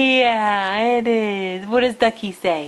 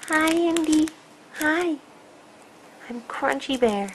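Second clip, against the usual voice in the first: toy bear voice; 7 semitones higher than usual. Pretend.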